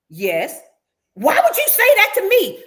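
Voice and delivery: acting out a friend's heated snap back; indignant outburst. Retelling the patio fight, a friend's loud offended demand.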